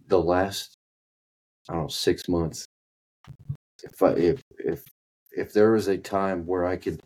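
usual speaking voice

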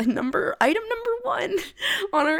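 high squeaky laugh breaking into the words. Real, not acted. Host announcing first outline item, laughing high. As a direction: laugh it out, pitch jumping up.

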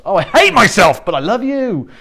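hammed-up self-pitying sigh, then a sweet I love you; mock self-loather. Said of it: "self-loathing's very in vogue".

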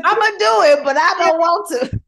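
high-pitched, very loud mock tantrum; reluctant protest. Grudgingly obeying God's order, loud playful protest. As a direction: wail your reluctant agreement at the top of your voice.

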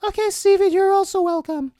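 high, far-off put-on doctor voice. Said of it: "with my imaginary voice and whatnot".

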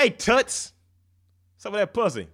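loud, high catcalling greaser; greaser tough guy. Shown as a demonstration. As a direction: holler it like a street tough. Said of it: "The cat call is number one".

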